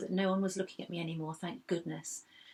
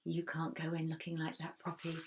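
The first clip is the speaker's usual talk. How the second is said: quiet inner voice; 7 dB quieter than usual. A narrator's reading. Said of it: "A little voice inside my head said".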